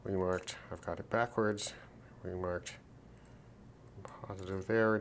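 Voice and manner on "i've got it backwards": ordinary delivery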